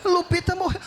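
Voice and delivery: sharp shouted repeat of Lupita morreu; shocked disbelief. Retold or imitated. Son shouting the news back at his mother, stunned. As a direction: blurt out the dog's death in shock.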